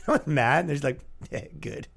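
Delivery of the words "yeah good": very soft, almost voiceless okay; quiet approval. Acted. Podcaster imitating listeners who aren't mad, hushed approval. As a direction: murmur it like a contented fan.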